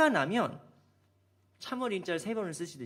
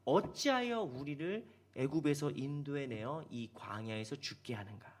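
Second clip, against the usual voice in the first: slow scripture quote; 5 semitones lower, 5 dB quieter than usual. Shown as a demonstration. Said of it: "하는 건 뭐냐".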